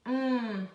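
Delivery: "Hum" as a long "mmm"; isolated M sound. Shown as a demonstration. Teacher showing the M sound, a held "mmm". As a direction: hum with lips closed, no vowel. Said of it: "é como se ele soasse como".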